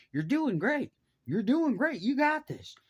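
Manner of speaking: high, loud cheering-on, imitating the boss; mock encouragement. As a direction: call out encouragement across the clearing. Boss cheering on the struggling cutter.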